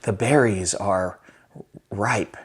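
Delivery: agonise over one line, softly; lightly hammed-up bit-part actor; overthinking actor. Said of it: "pokes fun of the whole L A scene".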